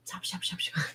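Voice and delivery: breathy whispered stop, stop; mock urgent whisper. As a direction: hush him under your breath. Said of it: "when I talk to him and go".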